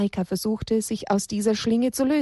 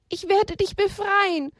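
tearful promise; 10 semitones higher than usual. Acted. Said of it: "versprach Haberle".

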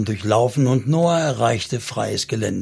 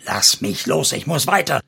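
angry demand. A narrator's reading. Man struggles to get free.

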